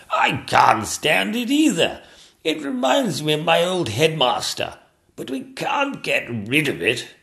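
high, slow put-on royal voice; a king grumbling loudly and drawing out his words. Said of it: "agreed the King".

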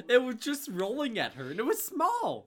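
high, laughing disbelief. Real, not acted. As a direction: squeal it while laughing. Laughing at the tomato death.

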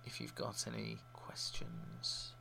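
soft unvoiced whisper; whispered aside. Real, not acted. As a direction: drop to a whisper at the end. Sign-off aside, drops to whisper.

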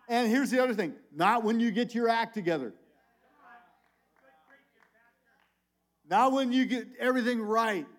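high, loud preacher's drive; full-force preaching. Acted. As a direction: push each line at the congregation.

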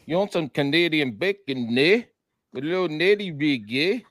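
badly faked Canadian accent with tagged-on "eh"; terrible Canadian impression. Co-host repeats his impression on request, bad Canadian accent. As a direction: do a hammy, awful Canadian accent. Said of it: "It's the worst impression of a Canadian ever".

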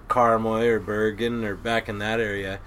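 ordinary talk, nothing marked

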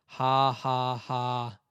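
imitated deadpan heckler's "ha, ha, ha"; dry, mocking spoken laugh; flat sarcastic laugh. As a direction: fake laugh, each ha separate on one level note. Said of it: "Like, flat and sarcastic".

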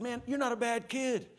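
put-on laying into the kid, voice up high; scolding rant. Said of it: "I was going off".

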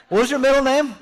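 high, laughing mock question. Pastor voicing Paul asking a name, amused high voice. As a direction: pop the question with a grin. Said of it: "mock dialogue about names".

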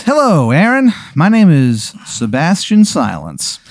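high, slow, silky villain intro; villain greeting. Acted. GM as Sebastian Silence introducing himself smoothly. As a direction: greet him like a smug villain.

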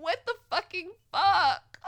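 high, loud cry of disbelief; exasperated disbelief. Retold or imitated. Reacting to his selfishness, a high, loud exclamation of disbelief. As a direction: blurt it out, appalled.

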